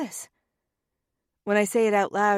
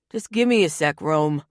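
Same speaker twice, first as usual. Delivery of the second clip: louder, called over his shoulder; yell back; 4 semitones lower, 5 dB louder than usual. Acted. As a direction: shout it back across the room. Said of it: "Tony yells back".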